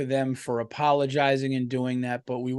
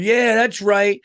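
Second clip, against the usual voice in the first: mocking a rowdy dad's loud, high cheer; 5 semitones higher, 6 dB louder, slower than usual. Dad at the party cheering his screaming kids, loud mock whoop. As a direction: ham up a loudmouth egging kids on. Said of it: "this one dude was going".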